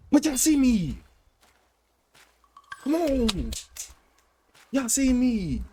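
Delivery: high-pitched crowing; excited boast. Real, not acted. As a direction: show off to the viewers, voice pitched high. Boasting to viewers.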